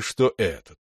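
usual speaking voice

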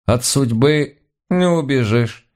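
high, slow granny voice; slow, high-pitched fatalistic sigh of words; resigned old housekeeper. A narrator's reading. Housekeeper crossing herself before facing the court, resigned high voice. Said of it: "перекрестилась наша домохозяйка".

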